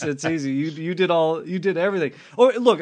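ordinary talk, nothing marked

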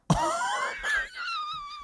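high helpless laughing shriek. Real, not acted. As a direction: burst into a squealing laugh. Bursts out laughing.

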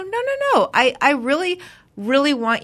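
very high mock-polite no; high squeaky voice. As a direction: squeak out the protest. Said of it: "I go".

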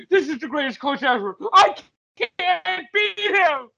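high, wailing mock coach; hammed-up despairing coach. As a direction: cry out in overblown awe and defeat. Acts out an awestruck, beaten coach.

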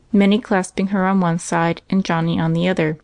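ordinary delivery

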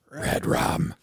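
low growled whisper; growly voice. Retold or imitated. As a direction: growl it like a creepy kid. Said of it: "taught her how to say in a growly voice".

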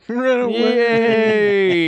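high, slow drawn-out call; sing-song cheer. Pretend. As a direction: draw it out high and playful. Cheering a player on.

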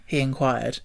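ordinary delivery, nothing marked

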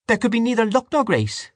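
put-on high, stern old woman; pious scolding woman. A narrator's reading. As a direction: rebuke them piously. Dante rebukes the family.

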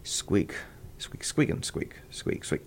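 soft, voiceless practice squeaks; trying it under his breath. Retold or imitated. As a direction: quietly test the words out to yourself. Trying the line out alone on a bench.

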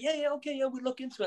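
high, noncommittal brush-off. Retold or imitated. Dana humouring them again.

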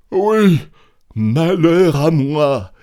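loud, high, drawn-out lament; aubergiste désespéré. A narrator's reading. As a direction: wail in despair. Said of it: "répondit l'aubergiste en tordant ses mains". (lamentation forte et traînante)

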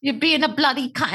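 Australian accent attempt. Co-host echoing the line she just heard, attempted Australian accent. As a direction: echo it in a bad Australian accent. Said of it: "I can't do an Australian accent".

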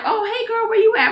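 high, chirpy late friend; imitating a careless friend; mock breezy friend. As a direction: sing it out like nothing's wrong. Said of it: "they're like".

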